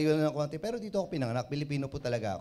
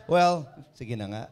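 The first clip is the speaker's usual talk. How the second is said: usual speaking voice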